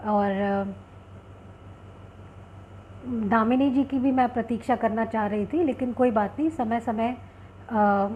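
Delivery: ordinary talk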